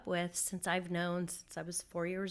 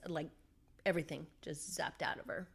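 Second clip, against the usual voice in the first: usual speaking voice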